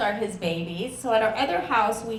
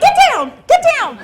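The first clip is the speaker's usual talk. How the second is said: high, loud yelled warning; urgent shout; 15 dB louder than usual. Retold or imitated. Mother yelling at kids during gunfire, high and loud. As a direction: bark it in panic. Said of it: "I'm like".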